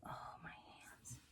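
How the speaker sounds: breathy whisper under her breath; real whispered complaint; whispered to herself. Real, not acted. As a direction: whisper it like nobody's listening. Muttering to herself while working.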